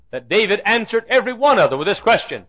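normal talking voice

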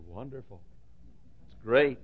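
soft deadpan, words without enthusiasm; feigned interest. Retold or imitated. Hyles answering his friend's gold news, soft and bored. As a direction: pretend to care, flatly and quietly. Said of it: "I'll say".

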